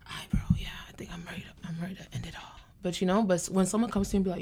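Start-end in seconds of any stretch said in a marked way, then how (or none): 0.1-2.4 whispered, barely voiced confession. A friend finally confessing dark thoughts, whispered. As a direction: whisper it like a hard secret. Mimicking someone finally opening up.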